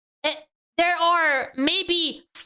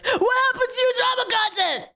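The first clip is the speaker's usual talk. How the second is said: mock shout; 9 semitones higher than usual.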